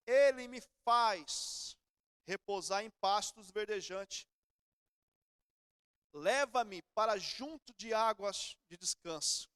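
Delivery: very slow, each phrase drawn out; weighty, unhurried psalm recital; slow solemn scripture reading. Shown as a demonstration. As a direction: read the psalm slowly and solemnly. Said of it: "Davi fala assim".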